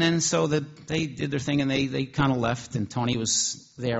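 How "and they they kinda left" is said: ordinary delivery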